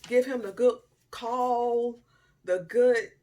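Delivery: loud, slow punch on good; emphatic. Real, not acted. A loud, slow stress on good about the call she never made. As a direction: lean hard on good, slowly.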